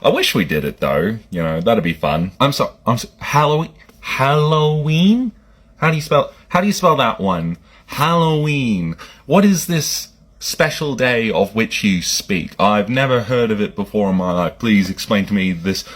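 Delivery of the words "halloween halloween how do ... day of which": mock bafflement. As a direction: play dumb about the word, stretching it. Pretending never to have heard Halloween.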